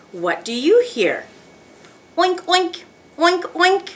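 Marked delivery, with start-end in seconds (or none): 2.2-3.9 high pretend oinks in pairs; pig oink. Teacher giving children a farm sound to guess, pig oink. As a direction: squeal out oinks like a little pig. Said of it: "I hear a pig".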